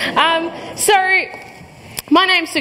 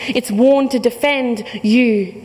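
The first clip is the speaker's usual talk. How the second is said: usual speaking voice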